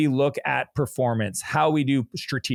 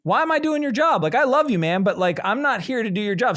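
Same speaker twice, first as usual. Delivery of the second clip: higher, half-laughing disbelief at Adam; a retold mild exasperation, pitched up; exasperated retelling; 6 semitones higher than usual. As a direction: replay the moment you told him off, amused. Retelling pushing back on HR director.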